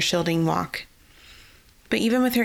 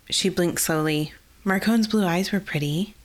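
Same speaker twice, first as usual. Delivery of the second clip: ordinary delivery, nothing marked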